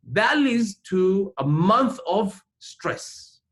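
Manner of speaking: ordinary talk, nothing marked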